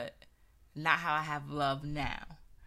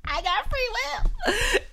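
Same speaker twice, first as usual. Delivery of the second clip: laughing; 10 dB louder, slower than usual. Real, not acted. Laughing at own joke.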